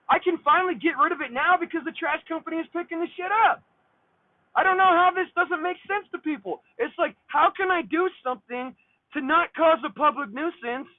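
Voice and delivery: exasperated, high-pitched venting; indignant rant. Real, not acted. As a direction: protest an impossible rule, worked up. Indignant rant at health department.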